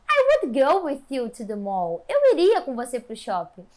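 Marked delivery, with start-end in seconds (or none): 0.1-2.0 emphatic, slower "I would go with you to the mall", lively falling tune; affirmative intonation. Shown as a demonstration. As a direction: punch "I would go" and let "to the mall" fall. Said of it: "Você vai falar o quê?". (entonação afirmativa, "I would go" com ênfase e final descendente)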